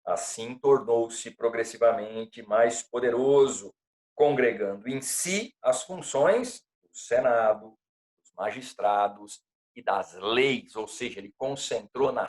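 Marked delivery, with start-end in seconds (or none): none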